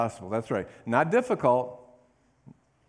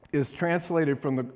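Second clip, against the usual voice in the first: usual speaking voice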